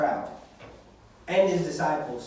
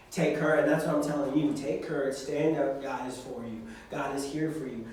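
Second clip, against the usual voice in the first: lower, softer reassurance; tender reassurance; 5 semitones lower, 5 dB quieter than usual. Real, not acted. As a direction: speak gently to each of them. Preacher softly reassuring youth.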